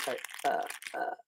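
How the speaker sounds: ordinary talk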